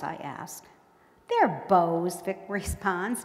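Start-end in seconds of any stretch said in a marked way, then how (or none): 1.3-2.2 disgusted. A narrator's reading. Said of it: "disgusted with my hesitancy".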